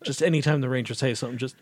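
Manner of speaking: ordinary delivery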